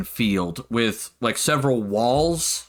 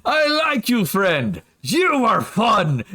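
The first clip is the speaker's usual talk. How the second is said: booming, delighted strongman, high at the start; slow, jovial praise, clapping him on the shoulders; hearty NPC; 7 semitones higher, slower than usual. Acted. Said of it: "grabs you by the shoulders and goes".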